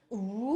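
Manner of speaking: "Wee!" starting low, then swooping up loudly; dipping third tone. Shown as a demonstration. Teacher imagines a diving board jump, "Wee!" dipping then rising. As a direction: dip "Wee!" down, then spring up. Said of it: "That's our third sound".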